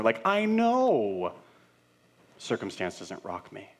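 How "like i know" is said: higher, slow, drawn-out "I know" in mock agreement; a slow, high, sarcastic "I know". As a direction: stretch it out like you've heard it all before.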